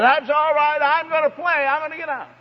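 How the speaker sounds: put-on high childish voice, cheerfully defiant; defiant little girl. Preacher imitates daughter brushing off her mother, high childish voice. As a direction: chirp it like a carefree toddler.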